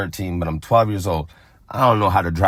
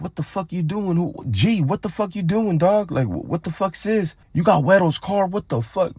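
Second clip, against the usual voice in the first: much higher, stunned homie voice; shocked homies; 11 semitones higher than usual. Retold or imitated. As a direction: play the homies freaking out at the stolen car. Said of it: "The homies are like".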